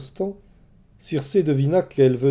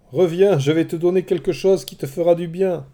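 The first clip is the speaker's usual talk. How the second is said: higher and louder call after a runaway; 6 semitones higher, 5 dB louder than usual. A narrator's reading. Circé calling after a pig running away, raised coaxing call. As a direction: shout it coaxingly across the clearing. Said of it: "cria-t-elle".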